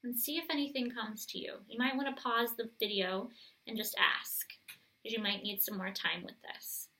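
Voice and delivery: soft, gentle guiding voice; calm guided meditation voice. Acted. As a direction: lead them inward, softly.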